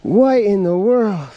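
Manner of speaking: slow, high and loud in disbelief; drawn-out astonished call at the catch; amazed shout. Real, not acted. Surprise at catching another bass.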